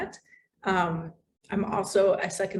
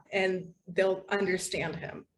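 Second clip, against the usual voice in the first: ordinary delivery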